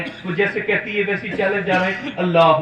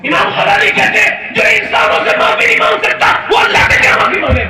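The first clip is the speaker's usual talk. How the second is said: preaching at full force; 5 dB louder than usual. Acted. Preacher roaring to the congregation about Umar. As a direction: cry it out to the crowd.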